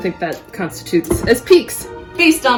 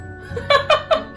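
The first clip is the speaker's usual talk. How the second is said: high breathy giggle; 13 semitones higher, 3 dB quieter than usual. Real, not acted. Breathy laugh.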